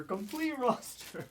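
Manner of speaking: high, slow imitation without the shout; drawn-out mock echo. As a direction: stretch it out high at normal volume. Repeats mock rant, softer and slower.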